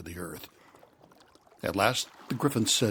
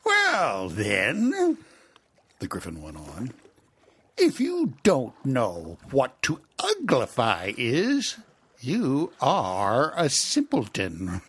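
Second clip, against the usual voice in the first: loud, slow mocking put-down; scornful Gryphon; 5 semitones higher, 7 dB louder, slower than usual. Gryphon calling Alice a simpleton, loud and slow. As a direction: talk down to her.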